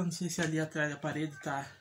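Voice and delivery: ordinary talk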